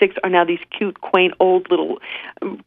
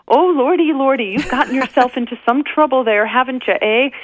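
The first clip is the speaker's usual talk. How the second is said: put-on much higher, sing-song Canadian accent; 7 semitones higher than usual. Canadian nurse teasing the stranded travelers, Canadian accent. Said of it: "I don't know if I can do a Canadian accent justice".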